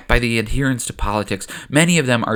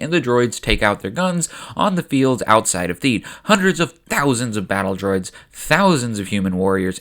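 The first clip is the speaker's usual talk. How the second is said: ordinary delivery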